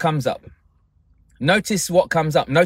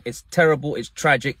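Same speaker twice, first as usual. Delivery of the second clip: ordinary talk, nothing marked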